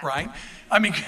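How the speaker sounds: ordinary talk, nothing marked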